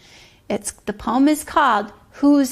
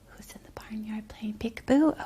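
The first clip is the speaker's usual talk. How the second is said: quick whispered rhyme line; hushed fast echo of the rhyme; 2 semitones lower, 8 dB quieter than usual. Acted. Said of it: "whispered repeat".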